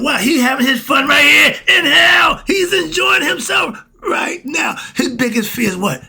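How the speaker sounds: shouting from the pulpit about the devil; preaching shout. Real, not acted. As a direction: hammer it home at full voice.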